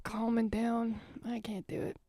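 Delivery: soft, trailing-off resignation; deflated admission. Acted. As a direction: give up quietly after the rant. Deflating after the rant.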